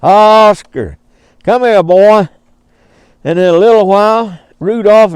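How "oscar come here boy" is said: mild calling to a far-off alligator. Retold or imitated. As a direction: call the alligator over the water, lightly. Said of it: "So he started calling".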